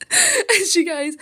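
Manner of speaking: words breaking into real laughter; cracking up at the memory; laughing while telling. Real, not acted. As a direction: giggle through the line.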